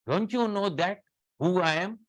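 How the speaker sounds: high, loud indignant demand; indignant patient. Retold or imitated. Patient scolding the receptionist, high and loud indignant. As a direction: snap it like an offended VIP. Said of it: "They talks".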